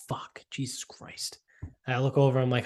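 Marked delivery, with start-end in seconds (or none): 0.1-1.4 slow whispered curse under breath; fed-up whispered groan; exasperated whisper. Retold or imitated. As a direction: mutter it so the stranger can't hear. Annoyed aside to himself.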